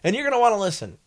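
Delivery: loud, high, punchy DJ tease; hyped radio DJ. Shown as a demonstration. DJ teasing the next song after the break, loud and high. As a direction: sell the upcoming song like a jock. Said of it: "I'm just doing it".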